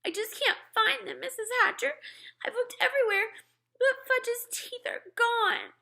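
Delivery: put-on high, tearful little girl; a girl near tears apologizing to the mother; sniffling girl. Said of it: "Sheila sniffled".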